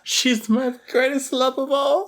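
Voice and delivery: high, slow mock swooning; lovesick swoon. Friend mocks Indy swooning over Elsa, slow high swoon. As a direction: draw it out like a besotted fool.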